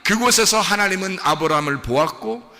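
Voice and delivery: high, shouted proclamation; full-force preaching. Real, not acted. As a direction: thunder out the names to the congregation. Climax of the sermon.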